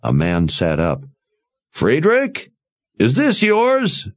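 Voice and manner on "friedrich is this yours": calling out. A narrator's reading. Calling to man at wagon.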